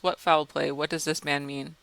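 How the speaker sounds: ordinary delivery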